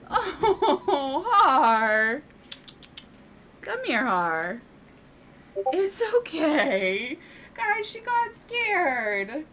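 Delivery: high, slow soothing coo with a laugh; soothing the scared cat. Real, not acted.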